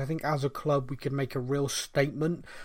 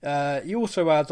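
ordinary talk, nothing marked

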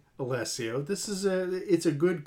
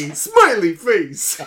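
loud, slow New York shout; thick New York accent; 3 semitones higher, 7 dB louder, slower than usual. Acted. Host echoing a listener's emoji, loud New York accent. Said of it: "Thick New York accent".

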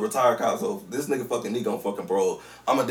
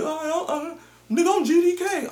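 a much higher, mocking voice for the stranger's boast; imitating a stranger; 4 dB louder than usual. Mocking a stranger from the car, high and hammed-up. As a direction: send the stranger's claim way up, as a caricature. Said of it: "and that motherfucker".

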